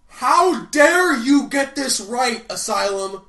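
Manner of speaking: ordinary talk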